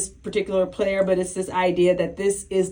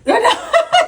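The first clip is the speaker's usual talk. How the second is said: high, loud burst of laughter; big high cackling laugh; 9 dB louder than usual. Real, not acted. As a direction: crack up suddenly. Co-hosts joke about being personal.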